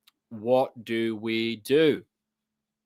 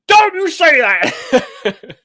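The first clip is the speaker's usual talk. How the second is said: hammed-up American yell, high and drawn out; American accent; 12 dB louder, much slower than usual. Mimicking an outraged politician, loud mock American accent. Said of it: "I did an American accent there".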